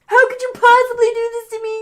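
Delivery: high, whimpering fake sob; mock crying. Host acting out Jay pleading with Roman, high mock weeping. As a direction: wail it in hammed-up betrayal. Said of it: "crying some more".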